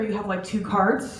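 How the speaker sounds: normal talking voice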